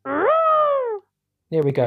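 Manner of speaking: high, slow trumpeting elephant call. Pretend. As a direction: trumpet it like a mammoth. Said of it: "elephant like this".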